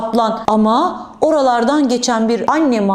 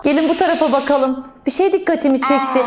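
ordinary talk

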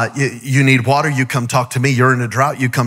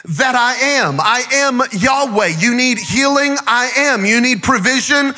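preaching at full force; 6 semitones higher than usual. Acted. Preacher calling the church to praise, building to a shout. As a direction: preach it higher and higher to the crowd.

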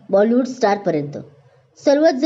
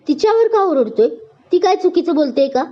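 indignant shout; 7 semitones higher than usual. A narrator's reading. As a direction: shout him down for yelling at her. Friend defends her in quarrel.

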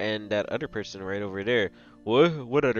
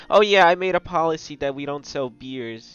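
usual speaking voice

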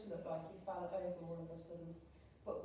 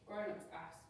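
ordinary delivery

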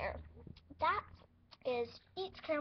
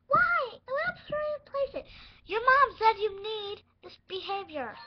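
much higher, much louder whiny wail; whiny protest; 8 semitones higher, 13 dB louder than usual. Pretend. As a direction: wail it like a child refusing the doctor. Pretend patient protesting doctor.

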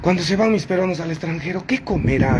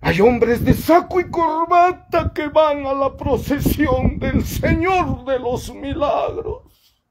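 indignant rant; 12 semitones higher, slower than usual. Real, not acted. Ranting against procession hypocrites.

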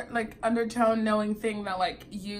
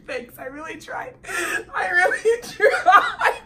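high laughing thanks, building louder; 12 semitones higher, 8 dB louder than usual. Real, not acted. Woman thanked for her accent attempt, laughing. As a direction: crack up while accepting the compliment.

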